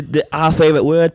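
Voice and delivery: ordinary talk, nothing marked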